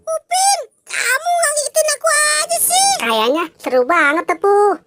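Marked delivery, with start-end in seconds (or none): 0.1-2.9 much higher, startled cartoon voice; startled squeaky figure. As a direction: squeak as if just jumped. Startled character voice. (suara kartun melengking kaget)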